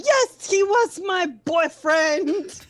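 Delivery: loud, drawn-out melodramatic outburst; Young Frankenstein bit. Acted. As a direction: belt it out slowly as a hammed-up confession. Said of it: "doing the bit from Young Frankenstein".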